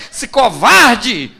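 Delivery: loud, drawn-out mock fury; outraged. Wife driven crazy by husband's denial, outraged. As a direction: hurl the insult, stretching it out loud.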